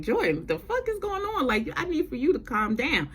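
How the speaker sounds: high-pitched imitated scolding; mock alarm. Javi running over to calm Jordan down, mock alarm.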